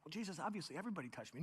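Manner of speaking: fast, soft, offhand; rushed Peter. Acted. Peter brushes off Jesus, fast and soft. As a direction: rattle it off dismissively.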